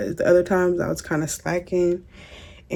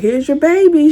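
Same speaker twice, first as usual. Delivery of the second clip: high sing-song; 10 semitones higher, 6 dB louder than usual. Retold or imitated. Mother-in-law presenting the baby.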